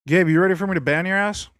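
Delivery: half-joking call-out to Gabe; mock threat. Streamer calling out chatter Gabe, mock threatening shout.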